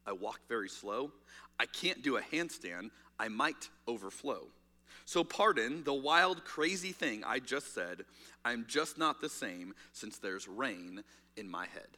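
slow, soft, low poem reading. Acted. Pastor opening the sermon with a poem, read softly and slowly. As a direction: let each line settle, slowly and softly.

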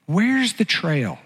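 very slow, stretched-out mock-bewildered question; drawn-out bewilderment. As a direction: stretch it out as if searching for the path. Acting out bewildered search for trail.

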